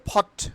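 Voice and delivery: short ফট opening on an aspirated p; aspirated P in POT. Shown as a demonstration. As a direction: say ফট with a puffed ph at the start. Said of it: "পটকে আমরা কি বলবো?".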